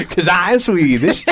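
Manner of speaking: laughing fake Swedish accent. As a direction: put on a goofy Swedish accent. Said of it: "never tried to do a Swedish accent".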